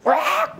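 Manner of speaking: high, harsh animal shriek; imitated water deer scream. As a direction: cut in with a sudden piercing cry. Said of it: "계속 소리를 들리는데".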